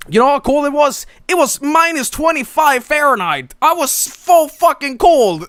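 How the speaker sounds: very high-pitched, strained comic rant; exasperated exclamation. Real, not acted. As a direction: exclaim it way up high, hammering home the misery. Ranting about the extreme cold.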